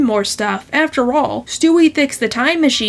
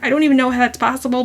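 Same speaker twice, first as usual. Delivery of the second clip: normal talking voice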